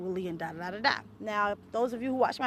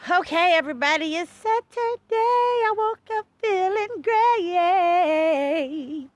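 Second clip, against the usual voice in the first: excited greeting; 9 semitones higher, 8 dB louder, slower than usual. Real, not acted. Hyped greeting to viewers.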